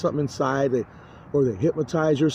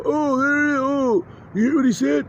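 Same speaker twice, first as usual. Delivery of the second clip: imitated intruding voice; 9 semitones higher than usual. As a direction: call it out high, mimicking a nagging onlooker.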